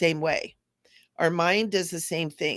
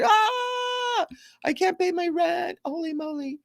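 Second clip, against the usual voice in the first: high panicked shout fading to a squeak; mock panic; 11 semitones higher than usual. The body panicking about rent, in a high mock panicked shout. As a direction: scream it in mock alarm over rent.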